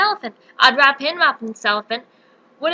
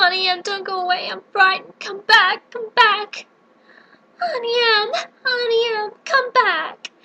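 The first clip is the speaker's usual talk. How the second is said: desperate sobbing plea; 6 semitones higher than usual. Acted. Dorothy begging Auntie Em to return, sobbing. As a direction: beg her to come back in tears.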